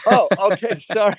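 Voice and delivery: real laughing realisation; amused apology. Real, not acted. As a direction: apologise through a burst of laughter. Realises mishearing, both laugh.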